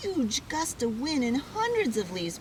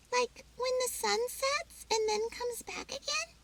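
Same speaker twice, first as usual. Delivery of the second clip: high squirrel voice. Acted. Pip asking Mom.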